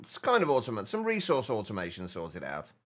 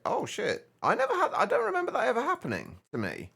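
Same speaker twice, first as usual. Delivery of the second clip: surprised; 11 semitones higher than usual. Real, not acted. Surprised at compressor behaviour.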